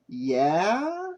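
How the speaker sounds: wary questioning yeah with a rise; a guarded, suspicious yeah?. A narrator's reading. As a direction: ask it like you don't trust her. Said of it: "he said suspiciously".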